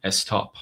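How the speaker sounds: "Estop" with a leading e before the st; Persian-style estop. Retold or imitated. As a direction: say "Estop" as two syllables, es-top. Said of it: "E S T O P".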